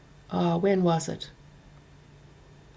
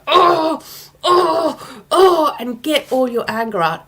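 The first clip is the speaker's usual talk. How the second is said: high, loud groans with a laugh; mock anger groaning; 5 semitones higher, 5 dB louder, slower than usual. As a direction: let the groans out, half laughing. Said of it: "you need to go on your hands and knees and go".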